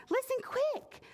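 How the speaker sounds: high urgent little ladybug. Acted. Ladybug rallying the animals, high and urgent.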